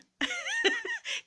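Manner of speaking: a piercing high-pitched cackle. Real, not acted. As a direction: cackle at your own cheek. Laughing at own cheek.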